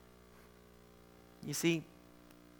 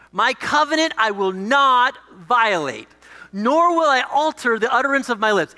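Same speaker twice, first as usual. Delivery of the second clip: raised, weighty declaration; emphatic scripture reading; 4 semitones higher, 4 dB louder than usual. Acted. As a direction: proclaim it firmly, leaning on each word. Reads God's vow from psalm.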